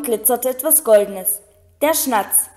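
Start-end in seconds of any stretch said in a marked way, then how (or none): none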